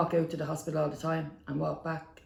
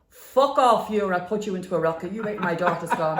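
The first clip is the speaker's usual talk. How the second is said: mock angry outburst; 2 semitones higher, 7 dB louder than usual. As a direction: imitate someone suddenly lashing out. Retold sudden outburst at bystander.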